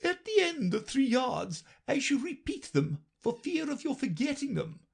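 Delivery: very high brisk queen; matter-of-fact and a little impatient. A narrator's reading. Queen answers Alice, very high and brisk.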